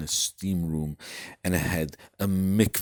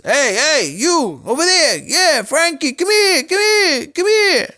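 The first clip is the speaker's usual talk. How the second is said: high, loud shouting for the servants; screaming for butlers; 12 dB louder than usual. Acted. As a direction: scream at the staff across the yard. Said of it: "starts screaming to all the butlers".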